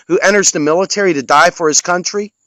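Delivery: normal talking voice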